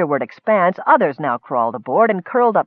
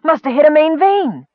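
high, loud, eager outpouring; excited gabble; 10 semitones higher, 4 dB louder than usual. A narrator's reading. Miner telling of the wine find, gabbling. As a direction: babble out the amazing news. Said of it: "he gabbled".

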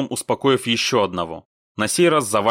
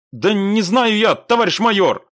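high, loud, helpless protest; exasperated; 11 semitones higher, 6 dB louder than usual. A narrator's reading. Squad leader answering pressing major, exasperated and loud. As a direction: protest to your superior that you're clueless.

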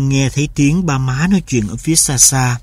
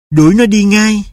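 higher, firm command; mother's harsh order; 5 semitones higher, 4 dB louder than usual. A narrator's reading. Mother orders the son to drive the dog away, stern.

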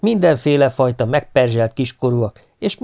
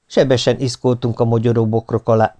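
ordinary delivery

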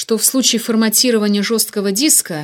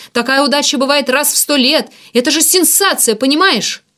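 loud, high, worked-up argument; heated insistence; 7 semitones higher, 6 dB louder than usual. A narrator's reading. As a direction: press the point with rising excitement. Ignat argues excitedly for his scoop. (возбуждённо, с жаром убеждает)

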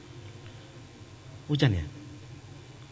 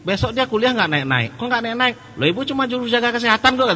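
put-on whiny child; 12 semitones higher, 5 dB louder than usual. Child complains about mother.

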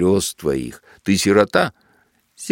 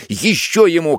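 Ещё ему repeated, pitched higher and pushed harder; higher shouted order; 7 semitones higher than usual. A narrator's reading. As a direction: escalate the cry Ещё ему. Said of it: "кричит во всё своё горло".

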